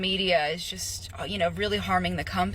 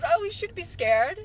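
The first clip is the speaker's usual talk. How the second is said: mock HR lady; 8 semitones higher than usual. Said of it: "She goes".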